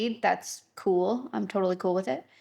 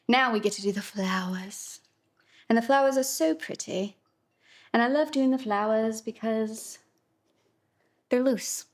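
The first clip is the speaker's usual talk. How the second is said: slipping into a British accent. Real, not acted. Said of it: "What accent was that?".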